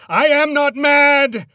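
piercing, drawn-out full yell; enraged yell. A narrator's reading. Man denying the ghost's charge of madness, yelling. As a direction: yell the denial slowly at the top of your voice. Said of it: "I yelled again".

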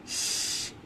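long hissing s sound, s- stretched like a snake; drawn-out s like a snake. Shown as a demonstration. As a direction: hiss the s- out slowly like a snake. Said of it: "It sounds like".

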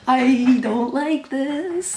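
high, very slow complaint; drawn-out unhappy whine. Real, not acted. Fan dreading how the game is going, drawn-out whine. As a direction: stretch every word out in dismay. Anxious fan before goal-line play.

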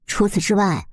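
ordinary delivery, nothing marked

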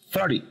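"thirty" as thirdy, T softened; T as flap. Shown as a demonstration. Teacher models thirty without a hard T. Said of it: "sin el sonido que produce la letra T".

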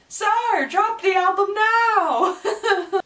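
high, loud, drawn-out playful plea; hammed-up fan begging; mock demand. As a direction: whine an impatient demand at your idol. Playful demand to the absent singer.

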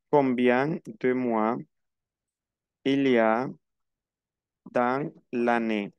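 slow, broken into chunks with long pauses; slow teaching repeat. Shown as a demonstration. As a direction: spell out the question chunk by chunk. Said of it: "Atención a la cuestión". (pregunta lenta, por partes)

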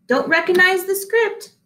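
a hint of a puzzled baker; cake maker voice. The cake maker answering the uncorker, lightly voiced.

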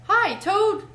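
high, loud hail; calling out. A narrator's reading. Mole and Rat call Toad to help, loud cry. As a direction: call out sharply to a friend. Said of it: "they cried".

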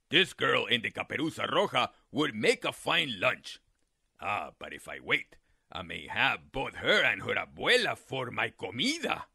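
low, scheming coyote thoughts; plotting to himself, deep and sly; low crafty coyote. Acted. Coyote thinking.

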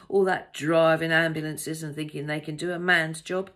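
defensive, opinionated traveller holding forth; affronted male passenger. A narrator's reading.

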